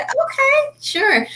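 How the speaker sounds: "Okay" pitched very high, then "sure" easing down; retold agreeable reply. Singer retelling her answer to baby brother, high-pitched agreeable reply. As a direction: quote yourself agreeing in a high voice.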